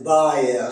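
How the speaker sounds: "buyer" for beurre, vowel split into ah-eur; Québécois diphthong. Shown as a demonstration. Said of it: "Le son E se prononce A E".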